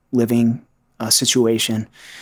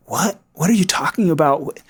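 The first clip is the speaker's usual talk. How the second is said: stunned, higher-pitched retold question; shocked disbelief; 5 semitones higher than usual. Husband hearing his wife doubt the marriage, shocked. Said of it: "I was shocked".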